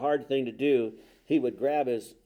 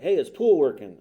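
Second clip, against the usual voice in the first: imitated street hail, higher-ending and louder; calling out; 3 semitones higher, 8 dB louder than usual. Young man stops an officer downtown, calling out.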